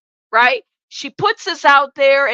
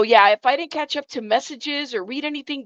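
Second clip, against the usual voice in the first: ordinary talk, nothing marked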